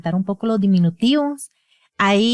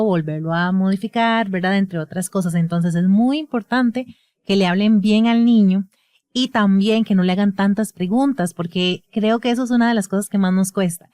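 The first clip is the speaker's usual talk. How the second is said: ordinary talk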